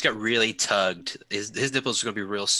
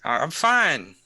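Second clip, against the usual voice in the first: loud slurred insistence; slurring drunk; 6 semitones higher, 4 dB louder, slower than usual. Acted. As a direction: slur it, acting tough. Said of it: "as you're slurring words".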